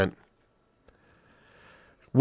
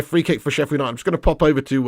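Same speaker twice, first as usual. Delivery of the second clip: ordinary delivery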